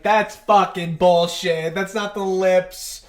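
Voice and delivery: slow, loud retold protest; drawn-out indignant comeback. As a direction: throw back a mock-outraged denial.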